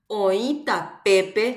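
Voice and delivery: OĨ TAPEPE very slowly, stretched out; slow demonstration reading. Shown as a demonstration. Teacher reads on for students to find the accent, slow demonstration reading. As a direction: draw out each word of OĨ TAPEPE. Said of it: "Van a leer la oración".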